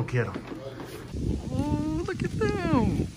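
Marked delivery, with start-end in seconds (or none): 1.5-3.1 mock slow motion.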